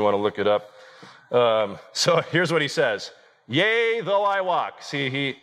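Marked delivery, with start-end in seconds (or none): 3.5-4.8 mock biblical prophet. KJV quote read in archaic voice.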